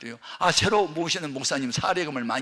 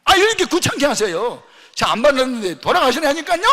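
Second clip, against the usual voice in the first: loud, high, exasperated housewife; annoyed woman; 11 semitones higher, 11 dB louder than usual. Retold or imitated. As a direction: snap at the pastor to go away. Annoyed woman over intercom. (인터폰 너머 짜증 내는 여자 집사, 크고 높게)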